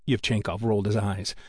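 usual speaking voice

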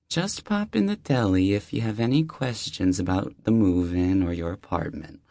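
high, friendly deli-lady voice; warm older neighbor. Acted. Deli owner welcoming the new neighbor, high and friendly. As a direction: greet him like an old friend at the door.

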